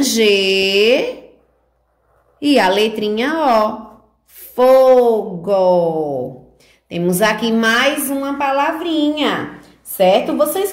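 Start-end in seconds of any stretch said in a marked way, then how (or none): 4.5-6.5 FOGO with long held syllables; slow teacher read-back, FOOO-GO; leitura silabada. Shown as a demonstration. Teacher rereads the written word. (leitura lenta e esticada)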